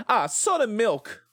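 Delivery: high, slow southern drawl; Benoit Blanc southern accent. Retold or imitated. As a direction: savor it like a southern detective. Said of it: "we're doing Benoit Blanc Southern".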